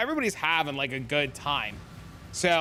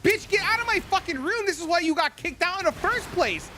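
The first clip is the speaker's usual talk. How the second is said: retold yell; 13 semitones higher than usual. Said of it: "You can't be yelling at them".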